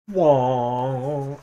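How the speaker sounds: loud spellcasting call; a sudden wizardly shout; dramatic spell cry. Acted.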